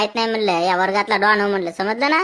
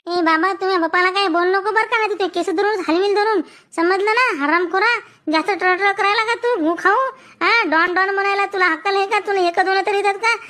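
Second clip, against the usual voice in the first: higher, bossy schoolgirl voice; bully him back like a tough girl; tough girl's threat; 7 semitones higher than usual. Acted. Girl friend threatening the boy on Babli's behalf, high and bossy.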